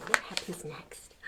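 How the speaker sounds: hushed, secret whisper. Pretend. Reading to kids, whispering what comes next. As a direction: lean in and whisper the question.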